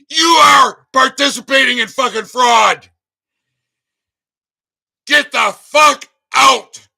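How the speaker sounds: ranting shout, slowing at the end; furious shout. Real, not acted. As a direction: tear into them at full volume. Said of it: "How was that for a rant".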